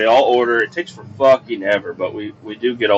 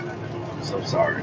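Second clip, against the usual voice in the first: breathy whisper, slower; whispered apology; 4 semitones lower, 12 dB quieter than usual. Retold or imitated. To grandma with bad knees, a soft whispered sorry.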